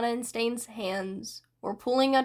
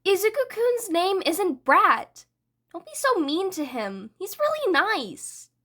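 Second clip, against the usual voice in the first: pouty scold; 8 semitones higher than usual. Acted. Toga scolding Dabi for insult.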